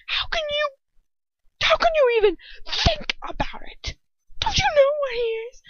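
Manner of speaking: very high, incredulous angry shout. A narrator's reading. Ron challenging Hermione about Lucius, shouting. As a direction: shout in horrified disbelief. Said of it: "he shouts".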